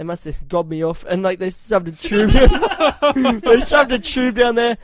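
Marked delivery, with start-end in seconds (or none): 1.9-4.8 laughing. Real, not acted.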